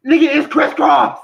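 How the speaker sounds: high, loud exasperated outburst. Real, not acted. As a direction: shout it in disbelief. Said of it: "look how loud I'm talking".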